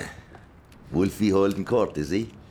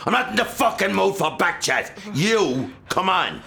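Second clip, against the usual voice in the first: very high, loud yell; father yelling at the family to shut up; furious yell; 13 semitones higher, 4 dB louder than usual. Acted. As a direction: blow up at everyone. Father losing temper.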